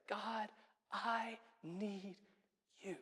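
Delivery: very soft, intimate plea; quiet, reverent confession of need; hushed prayer. Acted. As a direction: breathe it slowly, with pauses between words. Same prayer repeated as simple phrase.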